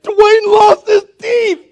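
put-on high, slow, upset little boy; alarmed child. Boy telling his dad what he found, alarmed and high. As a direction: tell it like a scared kid reporting disaster.

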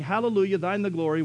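normal talking voice

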